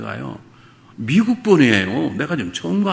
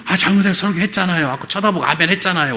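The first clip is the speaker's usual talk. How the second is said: higher, louder insisting to the elder; indignant protest; 7 semitones higher, 4 dB louder than usual. Retold or imitated. Pastor insisting to the elder that he preached, higher and louder. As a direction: press him, pleading it was obvious.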